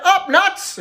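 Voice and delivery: called out high and sharp like a trainer; mock trainer's command; barked command. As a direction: bark the cue at the crowd. Doctor commanding patients.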